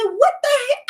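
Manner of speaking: high, loud disbelieving exclamation; loud, high-pitched astonishment; stunned "what the heck". Retold or imitated. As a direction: squeal it in shock. Learning water wasn't enough.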